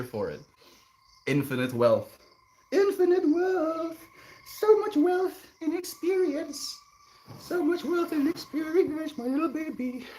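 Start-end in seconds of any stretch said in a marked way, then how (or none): 2.7-4.0 much higher and slower, sing-song; high silly voice. Pretend. Streamer repeating his own line to chat, high slow sing-song. 4.6-10.1 put-on cutesy baby voice. High pet voice to baby.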